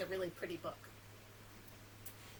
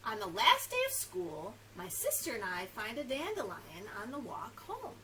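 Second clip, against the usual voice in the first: expressive, sing-song picture-book narration; lively storybook reading; reading to children; 2 semitones higher, 3 dB louder than usual. A narrator's reading. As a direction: read it to little ones, lingering on key words.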